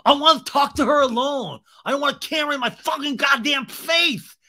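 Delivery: high, loud mock rant; hammed-up outraged whine; tantrum. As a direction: yell your demand at the crew. Acted Tom's tantrum.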